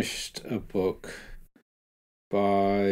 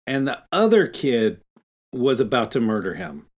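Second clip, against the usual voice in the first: louder, heated objection; indignant protest; 4 semitones higher, 6 dB louder than usual. Real, not acted. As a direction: push back hard for Billy. Indignant defence of Billy.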